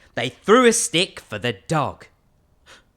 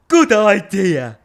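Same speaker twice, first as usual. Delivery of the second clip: louder, slow, emphatic approval; big skeleton agreeing; 4 semitones higher, 10 dB louder, slower than usual. Acted. Big skeleton agrees with the little skeleton, loud and slow. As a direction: draw out the approval heartily.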